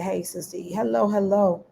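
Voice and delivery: normal talking voice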